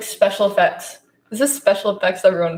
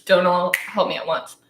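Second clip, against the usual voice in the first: ordinary talk, nothing marked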